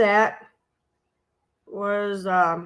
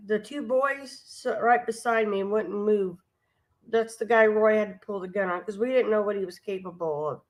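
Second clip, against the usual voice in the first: ordinary delivery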